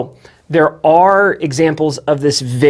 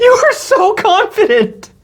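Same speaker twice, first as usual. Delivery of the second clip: very high, slowed by laughter; laughing hard; slower than usual. Real, not acted. Co-host teasing her confidence, helpless with laughter. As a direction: squeeze the words out mid-giggle.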